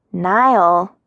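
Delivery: gentle cautioning call of a name; warning. A narrator's reading. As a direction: warn a friend to cool down. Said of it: "Muncie's voice warned me to cool down".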